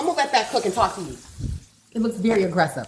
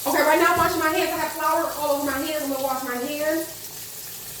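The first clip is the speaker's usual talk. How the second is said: usual speaking voice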